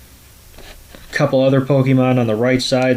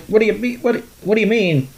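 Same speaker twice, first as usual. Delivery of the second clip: high-pitched, stammered question; mock bafflement; 9 semitones higher, faster than usual. Meowth questions the old man's order, mock bafflement. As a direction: imitate someone thrown, pitched well up.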